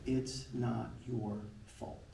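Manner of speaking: soft, slow, quiet comfort; gentle reassurance. Retold or imitated. Mentor comforting the crying boy, soft and slow. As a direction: lay the words down gently.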